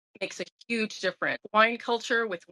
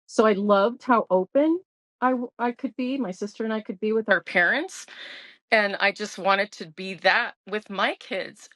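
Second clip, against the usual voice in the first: usual speaking voice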